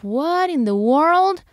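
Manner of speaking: high, slow what in the world; puzzled surprise. Retold or imitated. Hearing a strange noise while crying, slow high surprise. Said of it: "I'm like".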